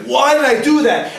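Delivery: high, loud self-reproach; hammed-up remorseful outburst; acted regret. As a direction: wail it at yourself in mock regret. Acting out regret afterwards.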